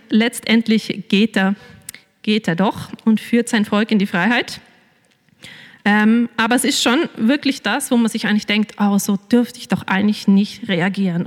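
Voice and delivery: ordinary delivery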